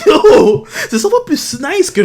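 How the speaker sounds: loud, high and laughing; excited. Real, not acted. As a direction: burst out hyped. Hyped about gladiator story. (très excité)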